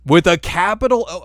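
playful disbelief; mock surprise. Real, not acted. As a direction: act amazed at the detail. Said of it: "that's definitive".

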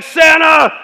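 high, loud excited yell of the name; freaking out. Retold or imitated. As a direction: burst out like a kid who just heard Santa is coming. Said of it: "And Buddy freaks out".

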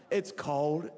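ordinary talk

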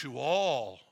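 "you all" with "all" weighted and falling; stress on all. Shown as a demonstration. Preacher reading Paul's love for the Philippians, stressing "all". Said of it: "emphasis on the word all".

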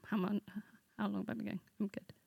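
ordinary delivery

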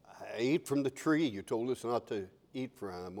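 very soft, low confession; hushed, sheepish admission; confessing Adam. Acted. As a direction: own up meekly. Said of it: "Adam didn't say".

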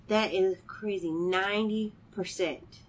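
normal talking voice

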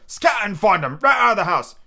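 high, loud command; reiterated order. A narrator's reading. Pew sending his men searching, calling loudly. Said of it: "reiterated Pew".

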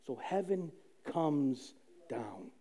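hushed. Real, not acted. Telling the congregation heaven came, hushed and slow. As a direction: let it sink in, almost a whisper. Repeated line, hushed then proclaimed.

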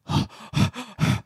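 theatrical heavy panting; panting breaths. Acted. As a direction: pant audibly, one breath after another. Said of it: "One is pants like the breath".

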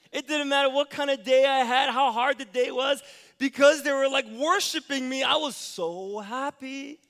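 loud, high, joyful telling; excited. Real, not acted. Pastor telling the congregation of his kids' welcome, loud and excited. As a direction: build the joy of coming home.

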